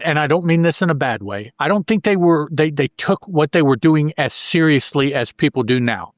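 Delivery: ordinary delivery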